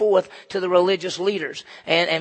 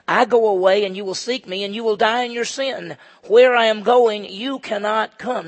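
Jesus' words proclaimed; 4 semitones higher, 6 dB louder than usual. Acted. Pastor voicing Jesus to the religious leaders, solemn and loud. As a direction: declare it like a prophecy.

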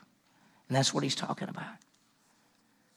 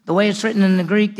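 usual speaking voice

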